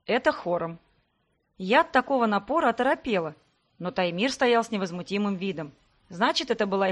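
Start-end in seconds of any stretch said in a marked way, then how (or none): none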